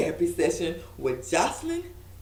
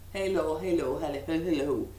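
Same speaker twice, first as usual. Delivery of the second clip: put-on Jocelyn's accent; 5 semitones lower than usual. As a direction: try out her accent on repeated hellos, badly. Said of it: "I can't do her accent".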